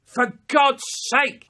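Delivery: high, loud exasperated cry; a loud, exasperated mum. Retold or imitated. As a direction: throw up your hands as mum.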